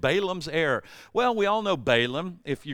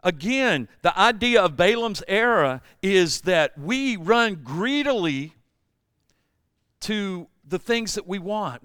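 ordinary talk

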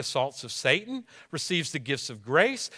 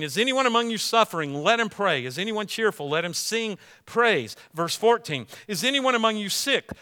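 raised, emphatic scripture read; louder preacher reading of James; 3 semitones higher, 5 dB louder than usual. Acted. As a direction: proclaim the verse to the congregation. Reads scripture aloud.

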